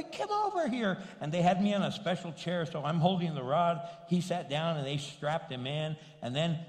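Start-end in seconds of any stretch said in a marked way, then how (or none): 0.0-1.0 high-pitched call to come; beckoning call. Retold or imitated. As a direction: summon him in a high, eager voice. Waves the doctor over.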